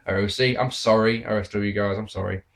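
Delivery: normal talking voice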